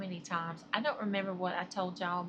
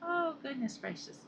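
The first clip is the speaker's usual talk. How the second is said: ordinary talk, nothing marked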